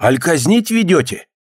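acted bystander's shout; calling out. A narrator's reading. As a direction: call out curiously to the guards. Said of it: "крикнул кто-то из народа".

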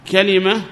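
three clear syllables in كلمة, ka-li-ma; first dialect form. Shown as a demonstration. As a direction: say كلمة as kalima, list-like. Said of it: "ففيه ثلاث لغات".

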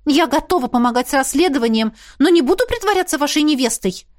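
high, insistent protest; exclaiming. A narrator's reading. Heroine refuses to pose as the dragon's bride, exclaiming. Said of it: "воскликнула".

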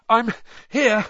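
high, loud and out of breath; panting. A narrator's reading. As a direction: gasp it out after a sprint. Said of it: "Harry panted".

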